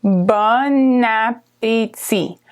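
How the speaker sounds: "Bon appétit" drawn out slowly with the liaison; slow demonstration. Shown as a demonstration. Teacher to learners, slow model of the phrase. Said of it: "and it said bon appétit".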